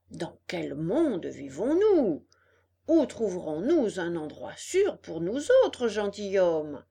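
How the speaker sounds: indignant fox. Acted. Fox laments unsafe world for gentlemen, grandly indignant. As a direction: draw it out like an affronted gentleman.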